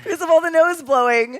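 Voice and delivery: high, loud, giggly explanation; amused outburst. Real, not acted. Actress explaining her nosebleed to the hosts, amused and high. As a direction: explain it loudly through rising laughter. Laughing at own nosebleed story.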